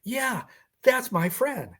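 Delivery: a sudden high lift of joy on recognizing a friend; euphoric. Retold or imitated. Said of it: "uh, euphoria".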